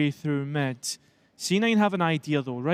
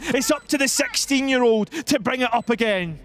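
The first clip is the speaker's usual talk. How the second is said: high, rousing esports commentary; live caster energy; excited play-by-play; 6 semitones higher than usual. Real, not acted.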